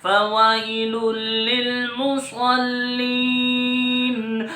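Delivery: sung Quran recitation, slow and drawn out; hadr recitation. Shown as a demonstration. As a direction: recite the whole sura melodically. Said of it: "اب میں حدر میں آپ کے سامنے پڑھوں گا". (حدر میں تلاوت)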